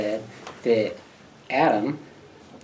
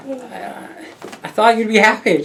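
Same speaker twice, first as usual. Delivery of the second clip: high loud hurt surprise; 10 semitones higher, 6 dB louder than usual. Retold or imitated. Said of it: "And I'm like".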